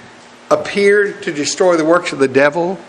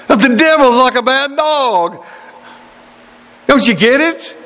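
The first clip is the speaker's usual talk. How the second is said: ordinary delivery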